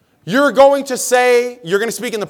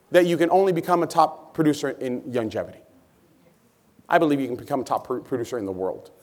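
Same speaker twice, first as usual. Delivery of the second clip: very low, soft voice; hushed confiding tone; 11 semitones lower, 10 dB quieter than usual. Real, not acted. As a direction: drop right down for dramatic effect. Speaker drops voice for dramatic effect.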